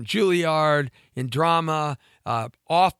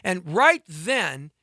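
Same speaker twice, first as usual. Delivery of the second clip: ordinary talk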